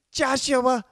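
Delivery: high, loud shocked mother shrieking Joshua's name; mother shouting her son's name in disbelief; shocked mom. Retold or imitated. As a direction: do his mom catching him naked, voice way up and loud. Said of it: "And she's like".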